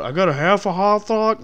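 a hint of Hank Hill twang. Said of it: "did I sound like fucking Hank Hill".

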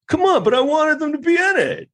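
very high, strained whine of a sore fan; high-pitched mock-whiny sports fan; whiny fan parody. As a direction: squeal it like a spoiled fan.

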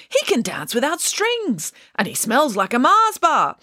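high, delighted wonder; excited cry. Acted. Circus crowd admires the puppet, excited cry. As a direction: marvel aloud in a high voice. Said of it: "they cried".

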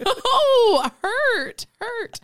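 high laughing cries of hurt; laughing mock hurt. Real, not acted. Mock wounded by friend's jab.

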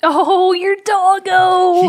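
high, loud, drawn-out cooing; gushing dog-lover squeal. Real, not acted. Co-host hears about Boomer the dog, gushing. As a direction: gush over an adorable dog.

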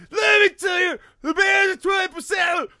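high, loud mock outburst; mock shouting. Teen daughter blowing up at her mom, shouting. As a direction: yell it out like she's lost it.

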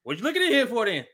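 high, hammed-up call to passers-by. Teacher calling to passers-by.

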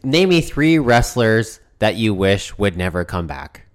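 normal talking voice

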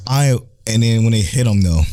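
ordinary delivery

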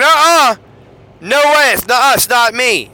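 hammed-up shouting it's not him; cursing denial. Peter cursing at the courtyard girls, loud mock denial. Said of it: "he started cussing, cursing".

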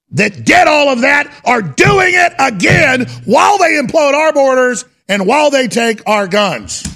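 loud high furious shout. Real, not acted. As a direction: yell it out in outrage. Ranting at the globalists.

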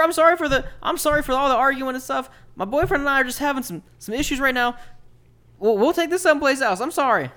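high, loud pleading voice; dwarf boyfriend apologizing to the neighbor; timid dwarf apologizing. Acted. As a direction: squeak out frantic apologies. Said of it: "He goes".

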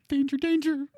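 high robotic warning call; alarm voice. Pretend. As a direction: sound the alarm.

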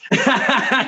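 high chuckle at his own story; real laugh. Real, not acted. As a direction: laugh while recalling the row.

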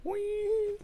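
very high thin squeal; imitated ear ringing. Describing his ears ringing while holding back tears, high squeal. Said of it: "my ears were like".

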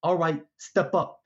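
a hint of the big shot giving orders; Big Notch. Boss telling visitors to step up, lightly voiced big shot. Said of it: "in time with Big Notch's voice".

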